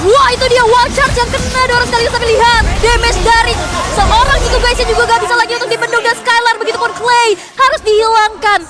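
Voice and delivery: loud, high hype call; excited shout. Real, not acted. Caster hype on team fight.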